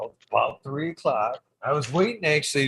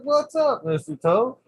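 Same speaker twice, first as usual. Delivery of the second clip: much higher, laughing hello; high amused greeting; 7 semitones higher, 3 dB louder than usual. Retold or imitated. Greeting the toad late at night, high and amused. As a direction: greet the toad like an old pal. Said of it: "I'm like".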